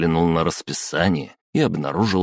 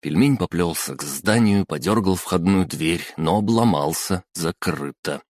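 ordinary delivery

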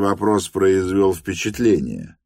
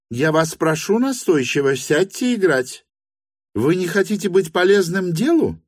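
high-pitched, insistent hostess voice; 12 semitones higher than usual. A narrator's reading. Hostess presses Lyamshin to play, high and insistent. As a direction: press the request in a much higher voice.

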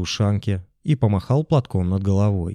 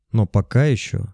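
plain reading voice

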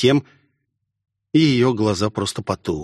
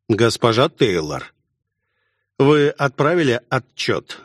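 stern. A narrator's reading. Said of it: "строго спросил я".

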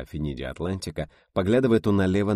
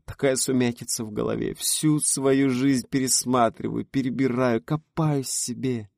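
plaintive whine; 7 semitones higher, slower than usual. A narrator's reading. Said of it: "жалобно заныла Рита".

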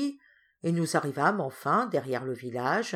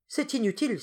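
higher-pitched, impatient exclamation; exclaiming; 6 semitones higher than usual. A narrator's reading. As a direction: cut in with a raised voice. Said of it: "s'écria Hippel". (exclamation vive)

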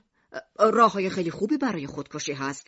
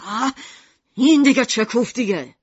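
loud, higher, irritated outburst; angry; 4 semitones higher, 7 dB louder than usual. A narrator's reading. Shopkeeper hearing music from upstairs, angry. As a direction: explode in annoyance at the noise. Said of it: "میشیما با عصبانیت گفت".

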